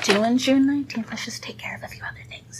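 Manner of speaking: sneaky whisper under breath; whispered aside. Acted. Jokingly slipping in bills under breath.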